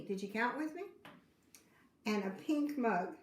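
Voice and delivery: ordinary delivery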